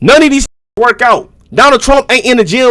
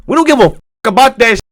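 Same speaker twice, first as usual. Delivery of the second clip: ordinary talk, nothing marked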